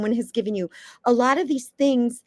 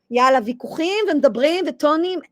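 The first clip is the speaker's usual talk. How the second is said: brisk Hebrew chatter, a bit higher; Israeli Hebrew; 5 semitones higher than usual. Retold or imitated. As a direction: rattle it off like an Israeli haggling. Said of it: "what seems just like".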